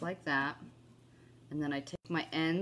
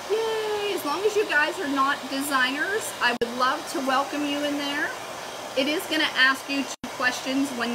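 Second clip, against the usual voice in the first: higher and louder, bubbly; cheery invite; 7 semitones higher, 6 dB louder than usual. Real, not acted. Welcoming viewers into her VIP group, bubbly. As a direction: sell the group with sparkle.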